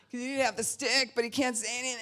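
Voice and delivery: high, straining to hold words in; mock contorting. Imitating little guy without the talking stick, squirming frustration. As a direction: squirm through it, high and bursting. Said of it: "he was contorting".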